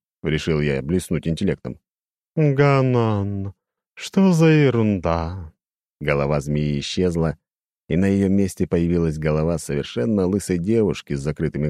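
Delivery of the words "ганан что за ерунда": high, slow, ringing demon voice; eerie chiming demon, slow and lofty; crystal ringing voice. A narrator's reading. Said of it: "прозвенел демон своим хрустальным голосом". (высокий звенящий хрустальный голос демона)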